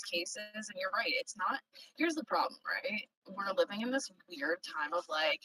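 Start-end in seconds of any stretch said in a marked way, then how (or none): none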